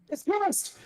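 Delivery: sudden high-pitched yelp; shocked outburst. Real, not acted. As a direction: blurt it out in shock.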